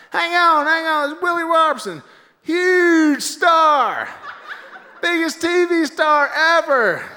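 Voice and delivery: high, loud rally announcer; Donald Trump. Retold or imitated. Trump presents Willie to the rally, loud impression. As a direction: trumpet it to a crowd, stretching the last words.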